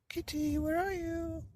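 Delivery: put-on high, calling old man voice. Old man calling for the missing cat, high voice. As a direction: call out for the lost cat, high. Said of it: "said the very old man".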